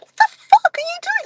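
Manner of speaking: hammed-up startled friend's high squeal; retelling a friend's shocked greeting, mock high squeal. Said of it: "She's like".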